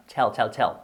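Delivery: "tell" repeated with a strong English t; careful pronunciation. Shown as a demonstration. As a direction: drill "tell" with an explosive t each time. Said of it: "Cuidaos la pronunciación, recordad".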